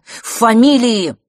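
high, loud, drawn-out exclamation; forceful insistence. A narrator's reading. Brother names the real issue to his sister, forcefully. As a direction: declare it slowly and emphatically.

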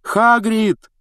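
loud high call through the door. Acted. Calling at the locked door.

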